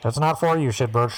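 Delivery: mock radio transmission; walkie-talkie voice. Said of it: "let me get my walkie-talkie out".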